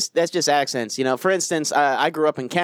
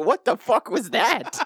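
retold bafflement; 5 semitones higher, slower than usual. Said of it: "I'm like".

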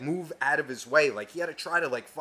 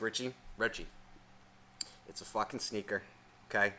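low, hushed talking himself down; soft and lower, reasoning with himself; calm self-talk; 6 semitones lower, 13 dB quieter than usual. Retold or imitated. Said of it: "And I'm calm".

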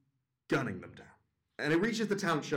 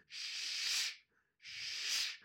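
long voiceless shush; 7 dB quieter than usual. Retold or imitated. Angry dad hushing the judge, long shushes. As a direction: hush her with a drawn-out hiss of air. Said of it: "he just starts shushing".

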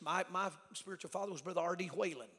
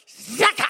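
loud, high imitated lightning zap; explosive zapping noise; 8 semitones higher, 20 dB louder than usual. Preacher imagining God zapping the woman, explosive zap. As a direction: blast out a sudden zap.

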